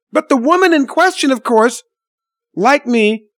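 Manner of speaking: usual speaking voice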